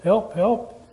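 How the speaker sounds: hint of a stranded climber calling out; mild mock cry for help. Climber on a cliff calls for help, understated. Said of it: "he started crying out for help".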